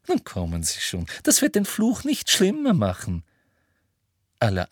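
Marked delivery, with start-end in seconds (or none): 0.1-3.2 coaxing Umbridge. A narrator's reading. Umbridge urges silent Marietta on, coaxing. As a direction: coax her along, voice climbing. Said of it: "ermunterte Umbridge Marietta".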